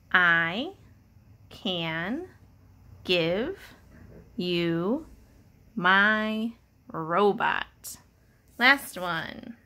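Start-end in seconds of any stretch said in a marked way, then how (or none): 0.1-8.0 each word spaced out slowly, pausing between; word-by-word reading. Shown as a demonstration. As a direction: read it one word at a time for a child. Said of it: "That sentence says".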